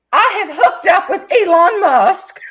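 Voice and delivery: high, loud, drawn-out retold confession; mock blurted confession. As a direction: blurt it out as a hammed-up announcement.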